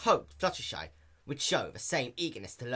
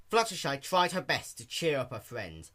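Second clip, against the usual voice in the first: plain reading voice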